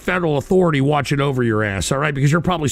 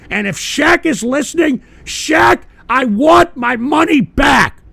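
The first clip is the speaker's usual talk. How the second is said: builds from raised voice to slow furious yell; angry shout; 8 semitones higher, 5 dB louder, slower than usual. Real, not acted. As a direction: yell the demand straight at Shaq, slow and huge. Demands refund from Shaq, building.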